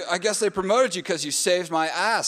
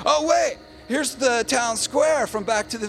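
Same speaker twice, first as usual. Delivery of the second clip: mock excitement; 7 semitones higher than usual. Tour guide pointing out set, high hammed-up cry. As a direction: jump in with a hammed-up thrill.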